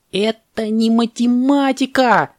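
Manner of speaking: high, drawn-out indignant cry; parrot's outcry. Acted. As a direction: protest in a stretched high voice. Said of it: "закричал попугай".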